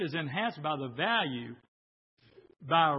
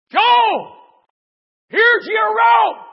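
full-volume high shout; calling down; 18 dB louder, much slower than usual. Acted. Helicopter rescuer shouts down to Joel in his burning house, yelling. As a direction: yell it down, stretching the words out.

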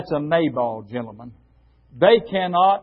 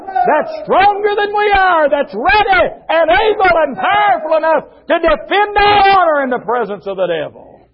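high, loud revivalist shouting; triumphant pulpit climax, shouted; full-force preaching; 13 semitones higher, 7 dB louder than usual. Real, not acted. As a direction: preach it at the top of your voice.

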